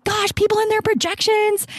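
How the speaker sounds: high-pitched exasperated outburst; worked-up exasperated exclaim; fired up. Retold or imitated. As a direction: throw it up high, fed up with people. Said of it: "all fired up about it".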